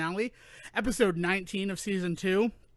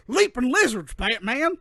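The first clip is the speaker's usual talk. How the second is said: put-on a goofy Burt Ward-style Robin accent, a touch louder; 3 semitones higher, 5 dB louder than usual. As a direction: sells the sidekick catchphrase in that goofy Robin accent. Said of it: "I don't know why he has that accent".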